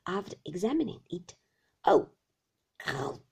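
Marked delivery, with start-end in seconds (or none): 1.8-3.2 short groan into a low guttural rattle; low throaty growl; throat rattle. A narrator's reading. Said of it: "Every time he uttered this ejaculation".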